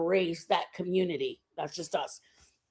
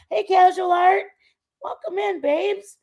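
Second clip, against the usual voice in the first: high, loud greeting; pitched-up, gushing welcome; excited welcome; 9 semitones higher, 8 dB louder than usual. Real, not acted. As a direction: call out a big hello to a newcomer. Greeting a viewer who just arrived.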